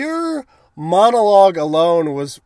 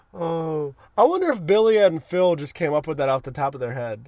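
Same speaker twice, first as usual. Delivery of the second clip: normal talking voice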